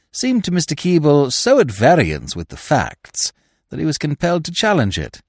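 plain reading voice

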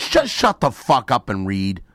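loud, slow angry order; exasperated, loud command to a fumbling reader; irritated outburst. Real, not acted. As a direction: cut the reader off loudly and forcefully. Irritated host cuts off reader.

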